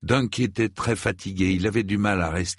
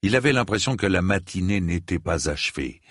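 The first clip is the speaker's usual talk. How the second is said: ordinary delivery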